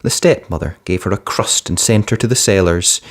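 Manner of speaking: plain reading voice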